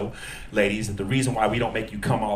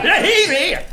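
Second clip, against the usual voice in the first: mock snitch; 6 dB louder, slower than usual. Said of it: "It's just like".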